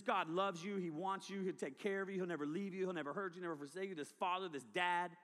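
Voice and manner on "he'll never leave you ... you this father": ordinary talk, nothing marked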